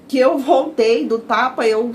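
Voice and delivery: ordinary delivery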